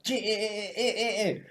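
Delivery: high, soft repeated "eh" bursts; short mock stabbing squeals; imitated stabbing noises. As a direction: voice repeated little stab noises.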